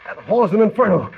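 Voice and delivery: high, loud frantic voice; frantic, racing against the fire; panicked. Acted. Hall in flames.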